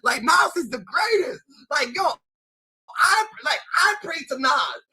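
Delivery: mock amused shouting. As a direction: shout it like people cracking up at him.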